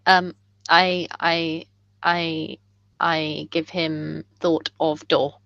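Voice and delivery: low, slow, simple-minded delivery; halting telepathic character. Acted. Player as character sending a thought, low and halting. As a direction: drop low and grope for each word.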